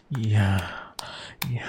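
ordinary delivery

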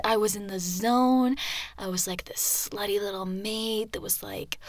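normal talking voice

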